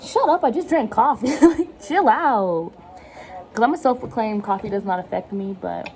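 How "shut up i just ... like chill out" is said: higher-pitched comeback, last words stretched out; mock exasperated retort. Coffee drinker answering people calling her manic, mock exasperated. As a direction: playfully tell them off, dragging the ending.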